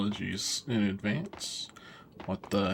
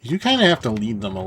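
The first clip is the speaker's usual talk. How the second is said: loud; 3 semitones higher, 11 dB louder, much faster than usual. Real, not acted. Excited gameplay commentary.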